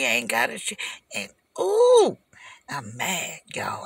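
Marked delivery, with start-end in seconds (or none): 1.6-2.2 sharp, loud, high-pitched ooh of fury; high angry ooh. Real, not acted. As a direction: let out a squeal of pure anger. Furious outburst mid-rant.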